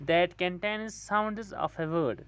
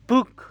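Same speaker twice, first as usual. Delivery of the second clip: बुक loud and higher, in one beat; one unbroken beat; 6 semitones higher, 9 dB louder than usual. Shown as a demonstration. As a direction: say बुक as one punched syllable, no break. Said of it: "यहाँ पर आपकी आवाज़ कब कहीं भी नहीं टूटी". (बुक ऊँची आवाज़ में, बिना तोड़े)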